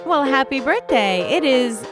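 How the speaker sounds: ordinary talk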